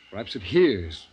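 usual speaking voice

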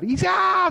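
sudden high loud shriek; widow's scream. Retold or imitated. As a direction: let out a piercing shriek of grief. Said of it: "Suelta el grito la señora".

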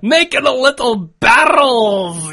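slow Scottish warm-up with rolling Rs; Scottish accent. Pretend. Cohost warming up the accent on barrels, broad Scottish. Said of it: "Do some making barrels".

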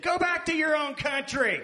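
loud, high mock-American shout; hammed-up American accent. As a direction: yell it in an exaggerated American accent. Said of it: "as much of an American accent".

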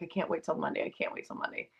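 softly whispered, fading on the repeat; hushed quote. Retold or imitated. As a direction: breathe it out, barely voiced. Friend's text quoted, repeated.

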